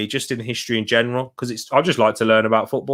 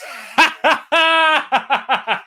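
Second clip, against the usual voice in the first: high burst of laughter; 14 semitones higher than usual. Real, not acted. Laughing at Jimenez's botched rabona.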